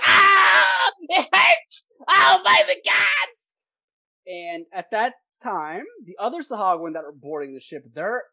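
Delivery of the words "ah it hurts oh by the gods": high, loud gnome cry of pain; hammed-up squealing agony; wounded gnome. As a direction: wail as a stabbed little gnome. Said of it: "and he's like".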